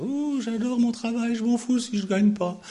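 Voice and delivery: high, airy mock enthusiasm; mock eager worker. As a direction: parody a worker who loves his job. (imitation moqueuse d'un employé ravi)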